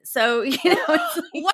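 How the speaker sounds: words half lost in real laughter; laughing. Real, not acted. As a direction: laugh through it as you speak. Laughing at own sponsorship story.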